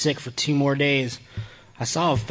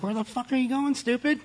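much higher-pitched, squeaky retelling; mock indignant question; 13 semitones higher, 3 dB quieter than usual. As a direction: play up the disbelief in a high voice. Acting out himself questioning his dad.

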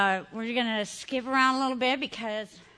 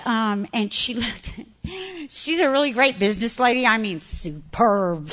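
normal talking voice

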